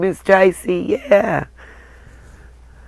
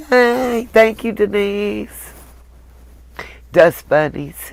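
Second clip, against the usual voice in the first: normal talking voice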